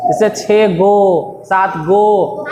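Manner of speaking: slow, leaning on each गो; drawn-out counting; stress on गो. Shown as a demonstration. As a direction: stretch out the count so गो stands out. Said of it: "जिसके पीछे गो लग जाए".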